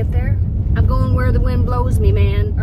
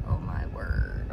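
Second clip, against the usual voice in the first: whispered; 13 dB quieter, much slower than usual. Real, not acted. Whispered reaction to the flies.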